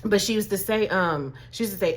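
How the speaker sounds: usual speaking voice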